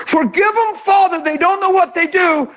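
tender forgiving Jesus voice; gentle forgiving. Retold or imitated. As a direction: say it like a merciful prayer. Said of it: "sounds a whole lot different than standing for your rights".

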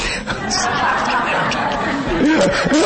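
bright high burst of laughter. Real, not acted. Preacher cracking up at his jogging joke, laughing hard.

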